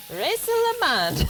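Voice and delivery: hammed-up French accent. Half-French streamer hams up the word for chat, exaggerated French accent. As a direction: belt out Rieselemand like an over-the-top Frenchwoman. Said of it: "I love being hilarious with accents".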